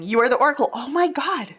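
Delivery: loud, high burst of real excitement; excited outburst. Real, not acted. Tarot reader realizing the card speaks to her viewers, excited outburst. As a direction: blurt it out as it suddenly clicks.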